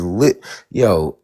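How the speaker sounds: ordinary talk, nothing marked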